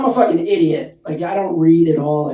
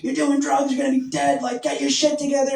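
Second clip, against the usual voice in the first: parent yelling; 8 semitones higher than usual. Retold or imitated.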